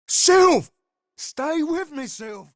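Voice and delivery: high cry dropping away; urgent pleading. Acted. Companion trying to keep Sylve conscious, urgent pleading. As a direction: plead with a friend to hold on.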